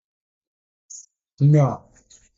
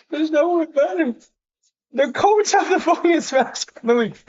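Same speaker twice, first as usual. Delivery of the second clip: ordinary delivery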